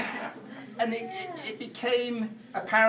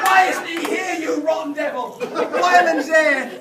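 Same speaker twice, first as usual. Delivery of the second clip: shocked outburst; 8 semitones higher, 7 dB louder than usual. Retold or imitated.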